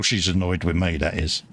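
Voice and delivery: ordinary talk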